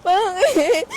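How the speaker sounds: hammed-up tearful cry at Charizard; mock sobbing yell; mock crying shout. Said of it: "Pinaiyak ako".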